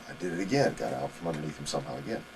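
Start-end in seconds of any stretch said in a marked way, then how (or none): none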